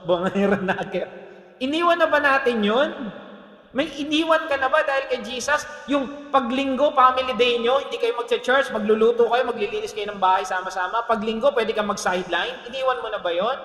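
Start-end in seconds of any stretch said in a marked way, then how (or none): 1.6-13.6 loud, raised-pitch challenge to the congregation; exhorting preacher, high and loud; full-force preaching. Acted. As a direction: preach it loud, pressing each question.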